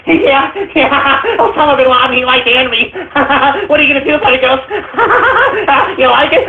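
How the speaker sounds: high, giggling fanboy voice; high, gleeful fan taunting Ghost through laughs; mocking anime fans. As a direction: squeal and cackle like a gloating fan. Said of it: "They're like".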